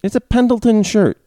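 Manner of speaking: high, loud retort; indignant. Real, not acted. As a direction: defend the shirt proudly. Defending his shirt.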